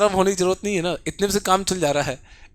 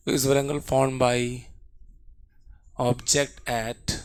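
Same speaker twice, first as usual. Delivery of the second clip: slow dictation; 5 semitones lower, much slower than usual. Shown as a demonstration. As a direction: dictate it so they can write. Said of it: "repeats the question slowly".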